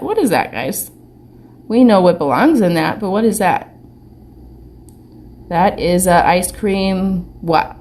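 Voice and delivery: ordinary talk, nothing marked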